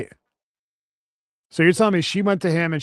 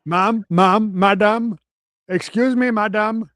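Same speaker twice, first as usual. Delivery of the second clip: mock Indian accent; 5 semitones higher, slower than usual. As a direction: protest like a scandalised employee in a hammed-up Indian accent. Employee protesting in put-on accent.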